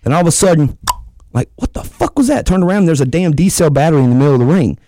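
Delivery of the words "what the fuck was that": startled yell. Retold or imitated. Retold shock at battery in ring.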